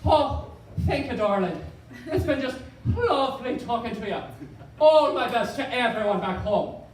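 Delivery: lilting imitation Irish accent. Comedian imitating an Irish girl calling home, in Irish accent. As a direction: put on a lyrical Irish lilt. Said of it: "the Irish accent for the English language".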